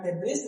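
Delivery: डेब्रिस ending in an s; wrong final s sounded. Shown as a demonstration. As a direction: misread डेब्रिस as "deb-riss". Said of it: "नहीं पढ़ना है".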